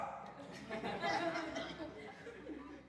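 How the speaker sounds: soft laugh. Real, not acted. Laughs after joke to congregation.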